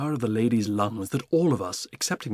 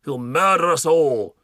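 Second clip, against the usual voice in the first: alarmed Duke; 4 semitones higher, 7 dB louder than usual. Acted. Duke refusing the Duchess, alarmed loud warning. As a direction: warn with bluster.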